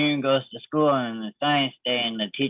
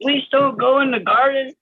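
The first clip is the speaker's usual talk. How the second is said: put-on very high, clueless child voice; innocent kid; 11 semitones higher, 4 dB louder than usual. Friend voicing the kid answering the angry dad, squeaky. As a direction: play dumb like a small kid.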